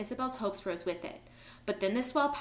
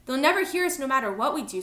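loud, high rebuttal; raised, pressing voice; urgent retort; 5 semitones higher, 8 dB louder than usual. A narrator's reading. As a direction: argue back over the noise. Shouted argument over tanker noise.

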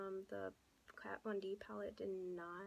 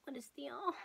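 pitched-up, drawn-in "mm" of approval; delighted bargain reaction; 5 semitones higher, slower than usual. Retold or imitated. Vlogger reenacts her reaction to a cheap lipstick, playfully impressed. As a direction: playfully act out being impressed, higher than usual. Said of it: "and I'm like".

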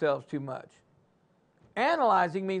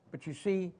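normal talking voice

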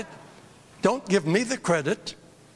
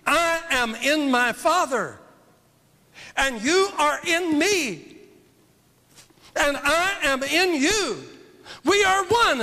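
building scripture proclamation; 9 semitones higher than usual. Real, not acted. Preacher reads scripture, rising.

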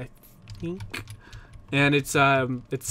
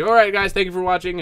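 bright-pitched, louder YouTube sign-off; outro sign-off; 4 semitones higher, 5 dB louder than usual. Retold or imitated. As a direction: launch into your usual video outro. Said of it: "I'm just like this".

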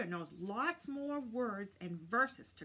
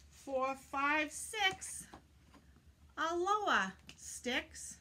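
cheery, louder counting with a high bright farewell; lively counting; 5 semitones higher, 7 dB louder, much slower than usual. Pretend. Children's host counting crows away, bright and loud. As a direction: count them off and wave goodbye.

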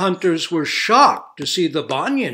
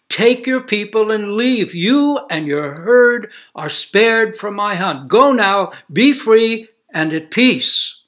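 higher, louder proclamation from a king; human king; 7 semitones higher, 9 dB louder than usual. A narrator's reading. Human king grants freedom to the deer herd, proclaiming. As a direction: declare it like a grateful king.

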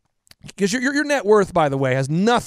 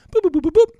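high, loud beeping boops mimicking keypad tones; phone dial beeps; 12 semitones higher, 6 dB louder, faster than usual. Dialing his dad to hire him, imitating the phone beeps. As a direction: chirp out each keypad tone.

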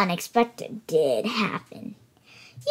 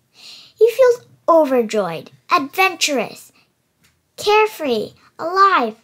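joyful; 4 semitones higher, 6 dB louder than usual. A narrator's reading. Telling kids how the flying squirrel feels, joyfully. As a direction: ride the joy up.

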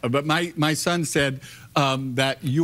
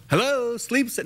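imagined cheery receptionist; 8 semitones higher than usual. Retold or imitated. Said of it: "you think they would go".

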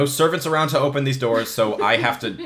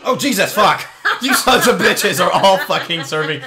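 higher, louder outburst with a laugh; laughing angry yell at the screen; yelling at the game; 5 semitones higher, 5 dB louder than usual. Real, not acted. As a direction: shout at the attacking enemies.

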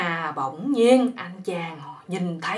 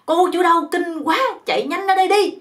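imitated furious shout, higher and louder; angry yell; 7 semitones higher, 5 dB louder than usual. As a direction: bellow for the owner at full force. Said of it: "anh quát một cái giọng".

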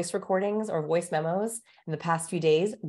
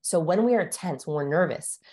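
ordinary talk

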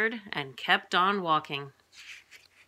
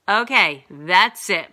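loud, raised, exasperated outburst; fed-up cry; 9 dB louder, slower than usual. A narrator's reading. Mom outside the diner, crying out. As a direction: cry out as if at your limit. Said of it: "my mom cried".

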